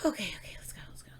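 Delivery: hushed, voiceless pep talk to oneself; whispered self-encouragement. Real, not acted. Reactor psyching herself up for the next song, whispered. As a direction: whisper it under your breath.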